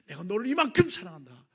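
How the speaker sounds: proclaimed. Acted. Loud after whisper.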